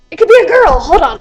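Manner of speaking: loud, eager burst of hope; excited outburst. Real, not acted. Excited phone text reaction.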